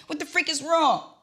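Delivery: high, loud, mock panicked demand; imitating her own panic; frantic demand. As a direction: repeat it, still pitched high. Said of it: "I didn't say freak".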